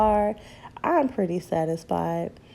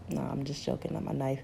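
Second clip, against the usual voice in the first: quiet joke takeback; 3 semitones lower, 8 dB quieter than usual. Real, not acted.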